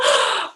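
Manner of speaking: gasp. Real, not acted. Mock shock at silence.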